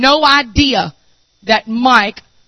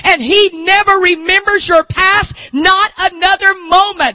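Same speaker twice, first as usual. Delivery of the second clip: voice breaking in tears; 8 semitones higher than usual. Real, not acted. Preacher overcome to the congregation, crying while preaching. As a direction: let the tears break through the shout.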